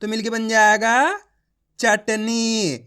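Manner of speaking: rising lead-in, then चटनी stretched slowly and falling; teacherly slow blend of चटनी; blended word demo. Shown as a demonstration. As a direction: join the sounds into a drawn-out चटनी. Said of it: "इनको आपस में लाके पढ़ लेना है".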